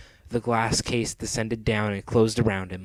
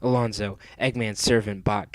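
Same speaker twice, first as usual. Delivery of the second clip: plain reading voice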